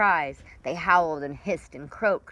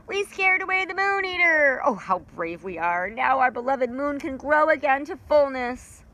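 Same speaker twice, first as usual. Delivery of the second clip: proud creatures; 7 semitones higher, 3 dB louder than usual. Acted. Creatures celebrate.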